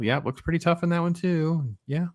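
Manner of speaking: ordinary delivery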